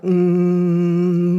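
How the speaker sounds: a steady held hum "Mm"; humming mm. Shown as a demonstration. Teacher leads the group hum, steady held "Mm". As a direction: hum "Mm" evenly through barely parted lips. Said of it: "start here in our throat".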